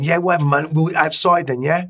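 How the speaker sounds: high, loud mock-tough taunt; hammed-up swagger daring him outside; cocky challenge. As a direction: square up like a street tough inviting a fight. Squaring up to the big guy.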